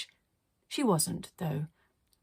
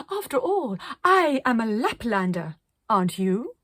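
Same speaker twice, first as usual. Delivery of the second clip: grand artist; 3 semitones higher, 5 dB louder than usual. Acted. Arcadia tells the children grandly, louder. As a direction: proclaim it.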